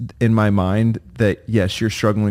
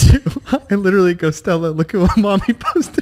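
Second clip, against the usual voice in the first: laughing; 9 semitones higher than usual. Real, not acted. Husband admits laughing at wife's posts, cracking up. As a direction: crack up while quoting yourself.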